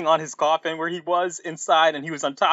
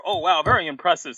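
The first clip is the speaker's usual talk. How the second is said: ordinary delivery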